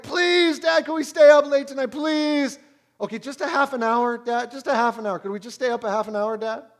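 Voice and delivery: put-on high, loud begging child voice; pleading kid. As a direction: beg Dad like a kid wanting a later bedtime. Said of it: "That's how my kids talk to me".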